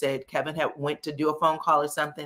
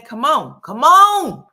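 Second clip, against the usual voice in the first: mock taunting call, pitched higher and louder as it builds; a Klumps-style taunt, swelling from a high call to a high loud shout; 10 semitones higher, 11 dB louder, slower than usual.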